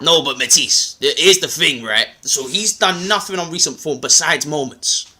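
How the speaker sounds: hammed-up London street accent. Explaining to doubting fans, in a London accent. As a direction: lay the London accent on thick. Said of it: "I'll do it in my London accent".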